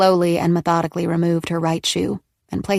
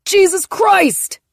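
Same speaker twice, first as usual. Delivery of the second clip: very high, slow, loud pained cry; cried out; 14 semitones higher, 6 dB louder, much slower than usual. Acted. Man with bleeding nose crying out, slow and loud. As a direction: wail it as the nose bursts. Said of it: "he cried".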